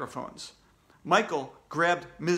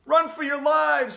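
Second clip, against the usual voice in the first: loud, high panicked cry; screamed warning; 11 semitones higher, 7 dB louder than usual. A narrator's reading. Somebody in the jammed hallway, screaming. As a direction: scream it to the whole crowd. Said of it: "somebody screamed".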